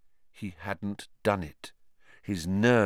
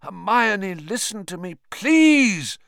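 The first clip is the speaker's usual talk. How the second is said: loud pleading shout; 10 semitones higher, 6 dB louder than usual. Acted. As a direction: shout to calm her down. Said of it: "Lupin shouted".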